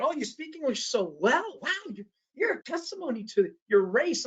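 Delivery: high, gushing fake praise, mocking a client; mock gushing compliment. Speaker mocking a client's backhanded praise, high gushing. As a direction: parrot the gushing compliment back with irony. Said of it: "I get".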